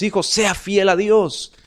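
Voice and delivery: lightly voiced father lecturing his children; parent admonishing. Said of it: "por más que les digamos a nuestros hijos".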